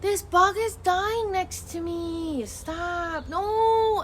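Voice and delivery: high, drawn-out real wail of distress. Real, not acted. Upset streamer sees a bug dying beside them, distressed wail. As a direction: wail it slowly, building to a loud, high "no".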